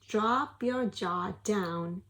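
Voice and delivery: normal talking voice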